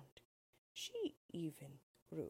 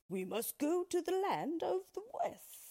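much higher, louder lion voice with a different timbre; rally the friends, pitched up high; resolute lion; 12 semitones higher, 5 dB louder than usual. A narrator's reading. Lion telling the friends where to go, lifted and firm. Lion's answer.

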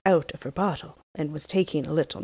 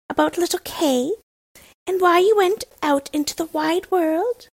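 put-on higher, louder bold robber girl voice; a wild girl demanding the story again; 10 semitones higher, 5 dB louder than usual. Robber girl questions Gerda in bed.

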